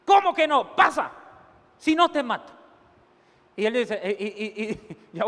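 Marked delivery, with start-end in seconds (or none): 0.1-2.5 louder, higher mock threat to the dog; bully the dog into moving; angry threat. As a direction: servant threatening the dog that won't pass.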